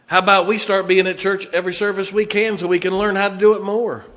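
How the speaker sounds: loud, high, driving pulpit voice; fiery preaching. Acted. Pastor rallying the church to come learn soul winning, booming preaching. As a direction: preach it hard to the pews. Preaching full force to the congregation.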